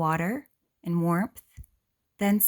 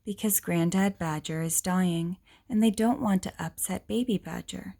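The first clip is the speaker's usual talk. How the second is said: ordinary talk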